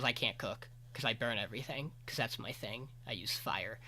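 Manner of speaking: sulking as he admits it; sad. Retold or imitated. Swordsman tells the group he can't cook, sad and soft. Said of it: "he gets sad".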